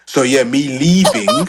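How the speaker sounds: slow, loud, stretched-out opening; loud drawn-out start. Real, not acted. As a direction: belt it out slowly, emphasizing leaving. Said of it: "you're not doing it right".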